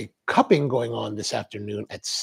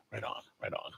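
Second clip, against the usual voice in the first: whispered aside; 3 semitones lower, 18 dB quieter than usual. Retold or imitated. Muted reply after Jesus look joke.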